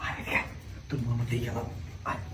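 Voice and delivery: low conspiratorial whisper. Acted. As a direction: keep it hushed and secretive. Secret talk, wife nearby.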